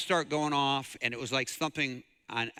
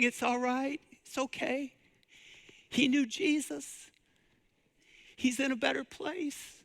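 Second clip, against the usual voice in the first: gentle consoling; 13 semitones higher than usual. Retold or imitated. Widow Kathy comforting sobbing nurses, high gentle consoling voice. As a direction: hold them close with your voice, tender reassurance. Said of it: "She starts consoling these nurses".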